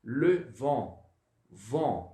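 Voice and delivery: French vent pronounced von; nasal vent. Shown as a demonstration. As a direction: vent with a rounded nasal on, t silent. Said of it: "Fica assim, ó".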